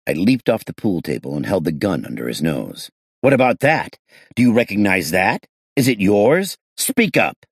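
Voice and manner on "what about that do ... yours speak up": loud, higher, threatening questions; loud interrogation. Acted. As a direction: shove the gun at him. Gun held under his nose.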